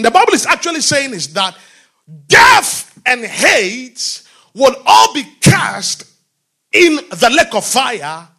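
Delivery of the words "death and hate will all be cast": death and hate will all be cast said very slow and loud; preacher hammers home the day of judgment; slow emphatic preaching. Real, not acted. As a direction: lean on every word.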